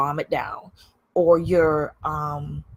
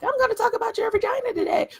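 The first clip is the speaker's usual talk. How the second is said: high squeaky mock voice, fast; mock silly voice; faster than usual. To viewers, mocking a spam-bait line in a high silly voice.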